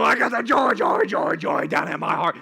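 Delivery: loud, deep, growly singing; a sour teacher belting a joy song. Retold or imitated. Teacher leads the Bible class song, deep and growly. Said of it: "she'd go".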